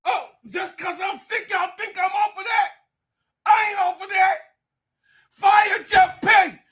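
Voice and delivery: very high-pitched screechy shouting; way up high, yelling the demand; outraged rant. Real, not acted. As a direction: shriek it like a protest chant. Mock-outraged rant demanding a firing.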